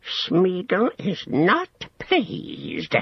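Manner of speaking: high, very slow creature voice; sulky Gollum. Acted. Gollum refusing to fetch herbs for Sam, sulky drawl. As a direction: draw the displeasure out, high and slow.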